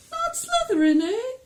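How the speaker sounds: very high, loud questioning squeak; weird high hat voice. Acted. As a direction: echo it back as a high-voiced, quizzical hat. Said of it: "that kind of weird high voice".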